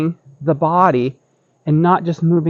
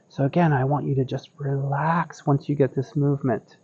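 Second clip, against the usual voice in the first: soft, soothing guided relaxation; serene tai chi cues; 3 semitones lower, 8 dB quieter than usual. Acted. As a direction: ease them into calm breathing. Guides relaxed breathing practice.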